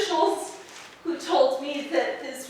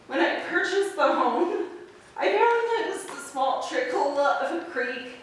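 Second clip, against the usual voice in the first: usual speaking voice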